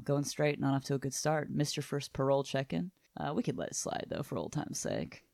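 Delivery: low-key, understated menace; soft-spoken mock crime boss; quiet threat. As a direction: make the point quietly, lightly threatening. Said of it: "very quiet, but gets his point across really threateningly".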